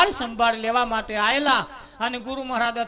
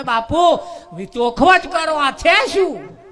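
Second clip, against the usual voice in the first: higher and louder, the last words drawn out slow; breathless disciple begging the guru to explain; urgent amazed question to Bapu; excited plea; 4 semitones higher, 5 dB louder, slower than usual. Retold or imitated. Disciple runs back amazed.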